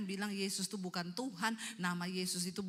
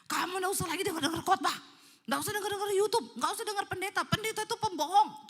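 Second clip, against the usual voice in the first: loud, high, furious acted rant; red-eyed possessed husband; 11 semitones higher, 8 dB louder, faster than usual. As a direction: rant angrily as a man possessed. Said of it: "matanya merah ngomong ke istrinya". (amukan suami yang kerasukan)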